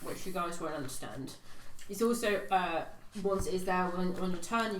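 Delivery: normal talking voice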